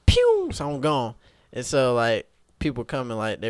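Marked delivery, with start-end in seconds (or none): 0.1-0.5 very high loud pew; zoom sound effect. Retold or imitated. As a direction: fire off a speed noise. Sound of running off.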